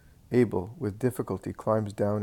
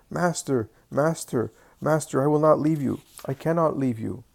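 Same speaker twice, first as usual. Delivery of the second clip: pleading cry; 5 semitones higher, 4 dB louder than usual. Acted. Healed leper begging to follow.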